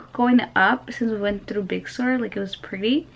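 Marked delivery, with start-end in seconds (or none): none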